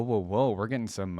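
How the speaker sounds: ordinary delivery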